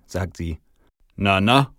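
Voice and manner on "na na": louder, grumbling reproof; mild grumble. A narrator's reading. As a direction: grumble a mild warning at a child. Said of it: "grummel ich".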